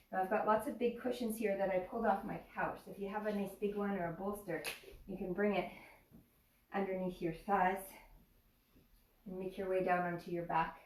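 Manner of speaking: usual speaking voice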